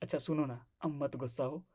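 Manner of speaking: soft, low plea to make up; gentle coaxing. Acted. Husband coaxing his angry wife to forgive him, gentle coaxing. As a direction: drop to a hushed, placating tone.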